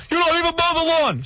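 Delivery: high, exasperated imitation of dad; yelling father. Father berating his son about chores, imitated yelling. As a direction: accuse them in a high mock rant. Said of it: "he'd start yelling at me".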